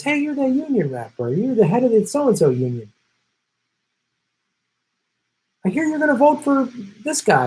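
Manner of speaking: high mock mobster, leaning in at the bar. Mobster taunting a union rep at the bar, mock menace. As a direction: play the smug wiseguy sizing him up. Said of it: "He goes".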